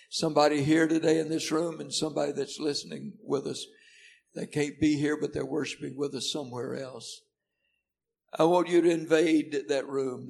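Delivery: loud, urging prayer, pressing on fast; fervent prayer. Acted. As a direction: pray with rising fervour. Pastor prays over congregation.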